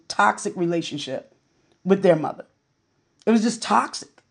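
usual speaking voice

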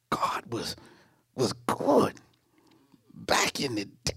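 near-silent awed whisper; whispered memory. Acted. Believer remembering God's goodness, in a whisper. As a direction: breathe the words as a secret.

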